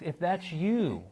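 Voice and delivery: ordinary talk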